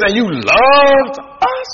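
slow, drawn-out rising disbelief; mock indignant question. Preacher mocking Israel's complaint, slow disbelief. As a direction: stretch it out in disbelief.

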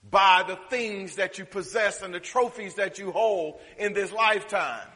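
usual speaking voice

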